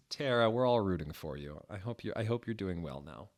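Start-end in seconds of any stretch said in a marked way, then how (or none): none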